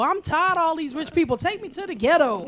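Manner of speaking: high, animated imitation of a restless star; playful retold protest; fed-up demand. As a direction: insist on being taken away. Father's demand, acted.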